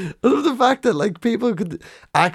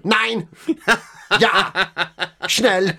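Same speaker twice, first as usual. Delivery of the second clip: higher, hammed-up German villain shouts; movie German villain; 8 semitones higher, much slower than usual. A movie baddie barking German words, hammed-up German accent. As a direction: play a cartoon German baddie. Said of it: "he says it in German".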